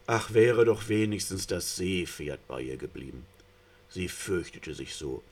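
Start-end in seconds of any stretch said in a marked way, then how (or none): none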